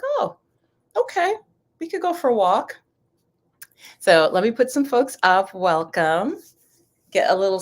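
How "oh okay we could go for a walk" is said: pitched way up in a light, pleasantly surprised quote; bright mock "oh, okay" with a much higher voice; mock surprised relief. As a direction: play the sudden sunshine like a happy little shock. Acting out sunny-weather reaction.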